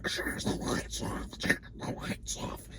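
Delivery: slow, breathy whispered repeat. Retold or imitated. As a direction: whisper it like a voice under static. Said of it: "But it was like".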